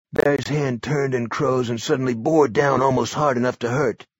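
ordinary delivery

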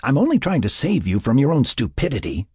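annoyed, insistent retort; exasperated. Acted. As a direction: defend yourself in exasperation. Sarah argues back crossly.

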